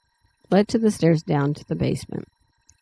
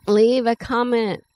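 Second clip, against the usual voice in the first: plain reading voice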